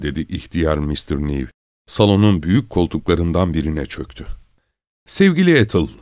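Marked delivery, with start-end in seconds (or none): 5.1-5.9 high-pitched calling out; mild exclamation. A narrator's reading. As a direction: exclaim in a raised pitch, volume restrained. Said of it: "diye bağırdı Marion". (tiz sesle, hafifçe bağırarak)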